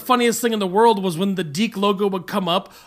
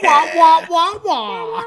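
high muffled wah wah; muffled wah wah talk; 9 semitones higher, 5 dB louder, much slower than usual. Retold or imitated. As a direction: mimic a muffled trumpet voice. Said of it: "that's the Bart Simpson bastard line".

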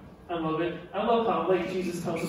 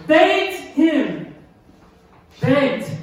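Begged hit hard and louder, high; stress on begged; 7 semitones higher, 8 dB louder, much slower than usual. Shown as a demonstration. Preacher repeating Begged to the congregation, emphatic.

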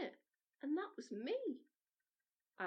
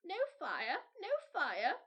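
put-on a dancing kernel pressing the girl to dance; popcorn creature urging; 5 semitones higher, 5 dB louder than usual. A narrator's reading. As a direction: Maisie Popcorn cries out to dance. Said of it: "repeated Maisie Popcorn".

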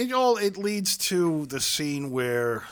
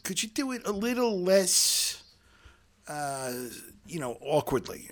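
imitating director; 6 semitones higher than usual. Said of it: "And Steinberg said".

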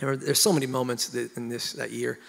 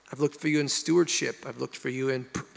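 usual speaking voice

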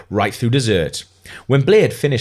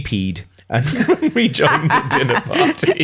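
higher, giggling narration that breaks up midway; laughing while reading; 7 semitones higher than usual. Real, not acted. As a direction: lose it laughing as you read on. Narrator cracks up at the line.

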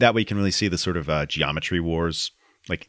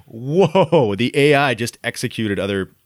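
surprised; 4 semitones higher than usual. Retold or imitated. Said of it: "I was like".

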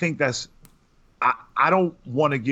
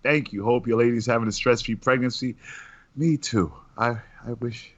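ordinary talk, nothing marked

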